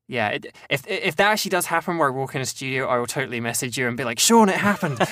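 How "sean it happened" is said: high, loud burst of excitement; excited announcement. Acted. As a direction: blurt out the big news to a friend. Acts out excited message to friend.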